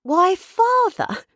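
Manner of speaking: high, drawn-out cry of a startled daughter; astonished cry. Acted. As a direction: exclaim slowly, high, at your father. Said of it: "cried Meg".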